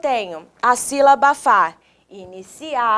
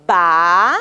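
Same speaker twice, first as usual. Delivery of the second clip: "Ba" stretched low, swooping up; drawn-out teaching syllable "Ba"; slow syllable; 6 semitones lower, 4 dB louder than usual. Shown as a demonstration. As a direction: hold "Ba" and lift it at the end. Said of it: "Vamos falar pausadamente?".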